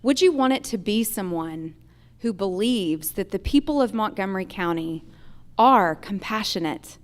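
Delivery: ordinary talk, nothing marked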